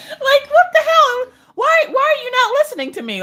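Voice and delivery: mock-outraged squawk at a device; exasperated yelling. Yelling at unresponsive Alexa.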